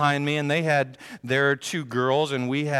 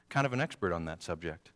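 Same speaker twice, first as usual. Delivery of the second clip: ordinary delivery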